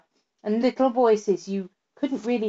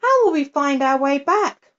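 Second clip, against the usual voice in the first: put-on frightened girl; 6 semitones higher, 4 dB louder than usual. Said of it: "she was suddenly frightened again".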